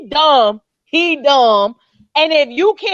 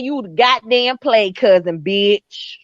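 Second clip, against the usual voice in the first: mock low, dismissive R. Kelly voice, lower than usual; R. Kelly quote; 8 semitones lower, slower than usual. Host imitating R. Kelly brushing off a cousin claim, low sneer. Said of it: "R. Kelly said".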